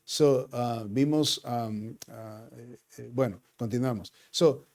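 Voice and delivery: fervent preaching. Acted. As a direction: preach it out to the congregation. Preaching at rising force.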